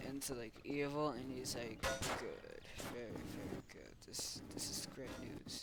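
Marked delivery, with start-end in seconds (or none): 2.2-5.6 very soft, whispery approval; hushed villain. Pretend. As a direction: murmur it low, like a scheming boss. Villain pleased, hushed, in play.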